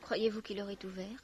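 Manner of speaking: breathy hushed question; whisper. Acted. Visitor whispering a careful question to the old woman. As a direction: ask it under your breath.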